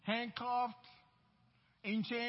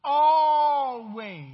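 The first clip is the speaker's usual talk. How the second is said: slow, loud preaching shout, louder on always; scripture yelled out; 3 semitones higher, 11 dB louder, much slower than usual. Acted. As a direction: yell it back at them, drawing it out. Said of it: "the scripture keeps yelling back to you".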